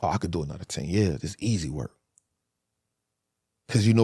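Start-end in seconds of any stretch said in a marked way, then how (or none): 0.0-1.9 softer, lower, offhand bravado; low, quiet, nonchalant boast; cocky, low. Retold or imitated. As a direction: shrug it off like nothing. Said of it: "telling myself".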